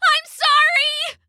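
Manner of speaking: slow, high crying wail. Acted. Guilty child sobbing an apology to her moms. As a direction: break down into tears on sorry. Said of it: "I wailed".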